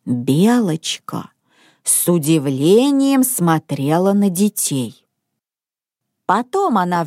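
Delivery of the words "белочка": plain reading voice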